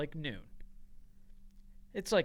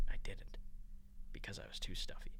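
whisper; 9 semitones lower, 10 dB quieter than usual. Real, not acted. Quiet aside, unvoiced.

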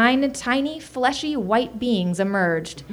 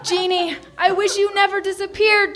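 high, anguished acted wail; 9 semitones higher than usual. A narrator's reading. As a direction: wail it out, pitched way up. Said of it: "Tony wailed".

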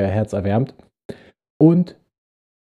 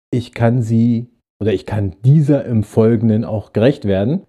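usual speaking voice

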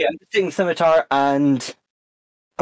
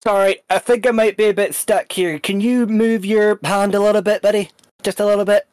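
mock-friendly menace; 7 semitones higher, 3 dB louder than usual. Player voices character threatening prisoner.

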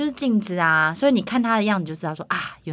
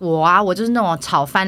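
ordinary delivery

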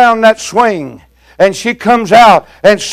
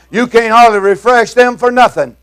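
usual speaking voice

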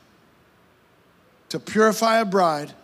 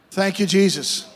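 ordinary talk

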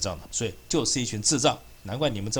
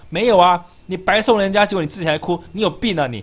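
angry rant; 7 semitones higher, 6 dB louder than usual. Real, not acted. Ranting at the official for crying.

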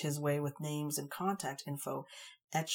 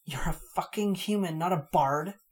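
furious fairy snarling at the human; 3 semitones higher, 8 dB louder than usual. A narrator's reading. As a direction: snarl it at him. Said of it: "She snarled".

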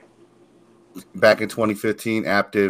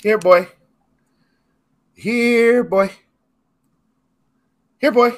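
high, loud doggy call; 13 semitones higher, 5 dB louder, slower than usual. Pretend. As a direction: call him like a pet dog. Calling Dax like a dog.

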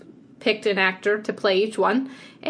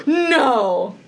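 shocked disbelief; 4 dB louder, much slower than usual. Real, not acted. Co-host learns both picked the same actor, shocked. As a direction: blurt it out in amazed disbelief.

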